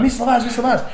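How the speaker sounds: put-on high, bright call of a small boy; eager little kid. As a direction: call out like an excited prep. Imitating the prep's eager call.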